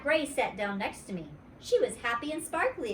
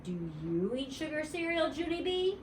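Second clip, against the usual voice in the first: curious; 8 semitones higher than usual. A narrator's reading. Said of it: "very curious".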